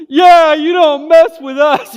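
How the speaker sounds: very high, very loud tearful yell, slow; crying kid yelling at the bully, high and loud; crying shout. Retold or imitated. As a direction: shout it through sobs like a little brother backing his hero. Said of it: "tears in my eyes".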